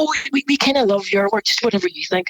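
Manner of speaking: lightly hammed-up clueless client; imitating a gushing client. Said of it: "And they go".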